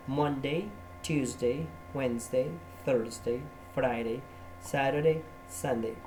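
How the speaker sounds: careful English "Monday", "Tuesday", "Wednesday" with clear English vowels; pronunciation drill. Shown as a demonstration. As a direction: model each day name in English.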